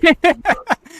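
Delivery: sudden high loud laugh; real laugh bursting out; burst of laughter. Real, not acted. Laughing at the host's joke about Pedro, loud laugh.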